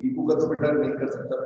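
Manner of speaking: normal talking voice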